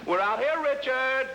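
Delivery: calling out. Acted. Calling out to friend inside.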